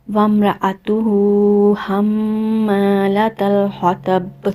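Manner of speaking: slow, drawn-out melodic tajweed recitation; Quran recitation. Shown as a demonstration. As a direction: chant each word long and measured like a qari.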